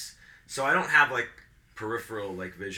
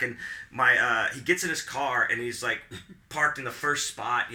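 ordinary delivery

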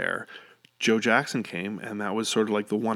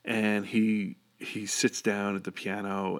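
ordinary talk, nothing marked

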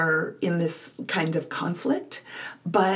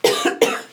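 short breathy cough; a dry rough cough into the mic; chesty cough; 5 dB louder than usual. Real, not acted. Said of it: "Sorry, I'm coughing".

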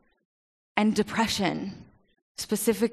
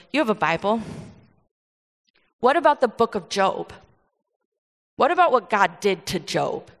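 retold frustration; 3 dB louder than usual. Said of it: "she got real frustrated".

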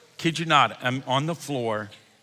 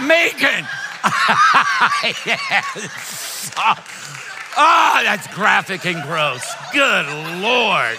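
laughing out loud; 7 semitones higher, 8 dB louder, much slower than usual. Real, not acted. Laughing at his own story.

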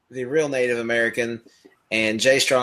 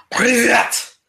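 loud, higher barked Russian hello; shouted greeting; 10 semitones higher, 4 dB louder than usual. Retold or imitated. Podcast host imagining greeting a Russian, loud mock yell. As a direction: bark it at a stranger like a mock Russian. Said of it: "I would say".

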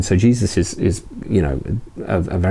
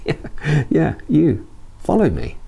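pitched well higher, light and coaxing, as Jesus; gentle inviting Jesus; 8 semitones higher, slower than usual. Retold or imitated. Jesus coaxing the hesitant tax collector, high gentle invitation. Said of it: "the tone or the intonation of that".

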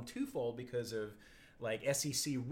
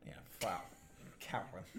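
ordinary talk

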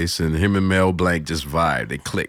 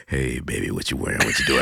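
put-on smooth boyfriend voice; 7 semitones lower, 4 dB quieter than usual. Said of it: "my sister's boyfriends would call".

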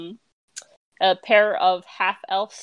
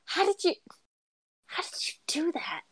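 high, hushed amazement; astonished; 8 semitones higher, 7 dB quieter than usual. Acted. Boy stunned by the magician's trick, astonished half-whisper. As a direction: fade into an awed whisper.

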